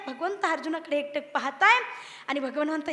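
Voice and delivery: ordinary talk, nothing marked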